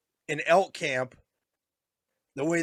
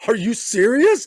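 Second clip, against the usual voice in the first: high, loud, slow disbelieving question; shocked disbelief; 8 semitones higher, 6 dB louder than usual. Retold or imitated. Son hears his dad's hunting tradition, high shocked disbelief. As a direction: gasp it out in disbelief. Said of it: "And I was like".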